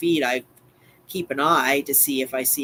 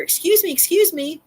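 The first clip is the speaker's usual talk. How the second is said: put-on high fluttery old lady calling out; dotty absent-minded old lady; 14 semitones higher, 4 dB louder than usual. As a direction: call after her like a dotty old neighbor. Neighbor calls out to her.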